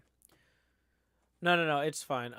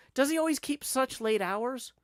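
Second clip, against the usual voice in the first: high, louder acted complaint; indignant questioning; 12 semitones higher, 5 dB louder than usual. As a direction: press the innkeeper theatrically for answers. Questions landlord in story dialogue.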